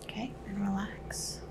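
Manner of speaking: slow soft whisper. Acted. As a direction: breathe the words slowly and gently. Whispered cue during exam.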